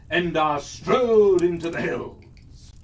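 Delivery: ordinary delivery, nothing marked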